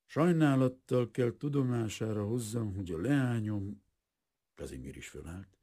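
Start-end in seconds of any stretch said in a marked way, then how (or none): 0.1-3.8 formal, cool announcement on a raised pitch; slow, cool. A narrator's reading. Father breaking news to his daughter's suitor, coolly formal. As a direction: deliver formal regrets coolly, voice held high. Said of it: "lassú, hűvös hangon mondta".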